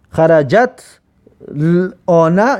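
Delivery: "خرجت الان" broken apart, xarajat, a long halt, then l-ona; unreadable double sukun. Shown as a demonstration. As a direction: stop dead on the t of "خرجت", then struggle into "الان" without a linking vowel. («خرجت الان» بریده، با دو ساکن و مکث)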